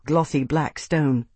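ordinary delivery, nothing marked

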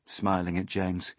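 plain reading voice

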